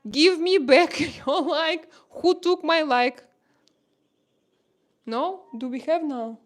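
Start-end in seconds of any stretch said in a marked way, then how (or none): 0.1-3.2 playful laughing protest. Real, not acted. Playful complaint about lost like.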